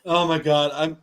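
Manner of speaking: low, spent after real laughter; crying from laughing. Real, not acted. Husband after Matt's teasing, crying from laughing. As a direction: catch your breath after a laughing fit. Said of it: "I'm crying from laughing so hard".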